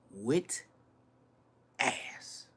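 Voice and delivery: soft whispered repeat of "With ass"; whispered echo. Real, not acted. To viewers, echoing himself in a whisper. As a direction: drop "With ass" to an unvoiced whisper.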